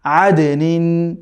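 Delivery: আদ নিন drawn out very slowly; slow, careful demonstration; slow model reading. Shown as a demonstration. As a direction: stretch আদ নিন for the class to copy. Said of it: "তো সব মিলিয়ে কি হলো?".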